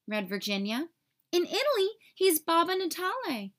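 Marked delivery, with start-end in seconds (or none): 1.3-3.5 put-on high eager boy calling out a fact; lively boyish cry; excited cry. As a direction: blurt it out thrilled. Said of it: "Ollie cried".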